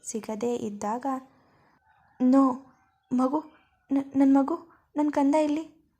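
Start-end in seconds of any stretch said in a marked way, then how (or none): 2.2-5.9 put-on loud panicked scream for her baby; frantic screaming. Siri wakes in hospital and screams for her lost baby, frantic and loud. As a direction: cry out desperately, breaking off mid-word. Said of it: "ಒಂದೇ ಸಮ ಜೋರಾಗಿ ಕಿರುಚಿಕೊಳ್ಳೋಕೆ".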